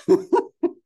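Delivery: short, high, loud laugh. Real, not acted. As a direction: burst out in a brief high laugh. Speaker laughs after joke remark.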